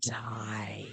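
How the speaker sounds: drawn-out whispered threat; villain's hushed verdict; menacing whisper. Acted. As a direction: hiss it softly, stretching the word. Flowey's threat read aloud.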